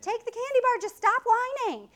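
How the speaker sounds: high, loud, fed-up giving in; exasperated mom. Retold or imitated. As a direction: mom who just wants the whining to stop.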